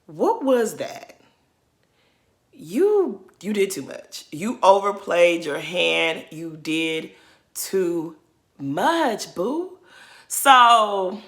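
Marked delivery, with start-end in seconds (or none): 0.0-1.2 What was that said slowly, drawn out; slow, incredulous What was that; slow disbelief. Shown as a demonstration. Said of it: "repeated question". 6.3-9.3 You did too much with pauses between words; slow, word-by-word scolding; spaced-out emphasis. Real, not acted. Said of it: "said word by word".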